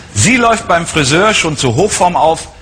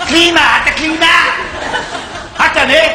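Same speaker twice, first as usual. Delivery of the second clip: proll car buyer; 9 semitones higher than usual. Acted. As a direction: gush over the new car like a show-off buyer. Same buyer character throughout.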